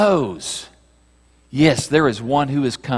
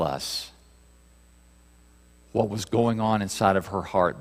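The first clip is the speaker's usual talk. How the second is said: softer, intimate preaching; lightly hushed reverent reflection; hushed sermon voice; 5 semitones lower, 7 dB quieter than usual. Acted. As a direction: draw them in close, quietly.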